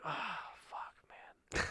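soft, voiceless groan of defeat; hushed, resigned cursing; deflated whisper. Retold or imitated. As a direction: whisper it in dismay. Retelling deflated reaction.

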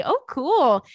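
high, loud excited reaction. Real, not acted. Reacting to cruise invite.

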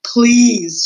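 loud, high, drawn-out please; shouted. A narrator's reading. Botticelli fed up with Roscuro, shouted. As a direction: shout it in exasperation. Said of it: "shouted Botticelli".

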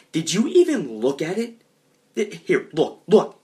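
indignant, insistent telling-off; angry scolding. Acted. Acted angry slap and scolding.

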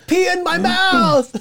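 high squealing put-on voice with a laugh; mock fan squeal. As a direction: ham up a crazed fan begging. Mocking a fan's wild request.

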